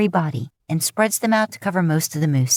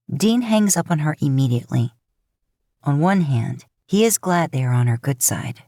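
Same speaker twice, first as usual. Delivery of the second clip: ordinary delivery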